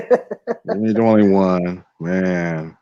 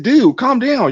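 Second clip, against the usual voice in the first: high, breezy mock of a clueless coworker; coworker's dismissive voice; 13 semitones higher, much slower than usual. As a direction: brush him off like he's overreacting. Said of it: "that's exactly how he sounded".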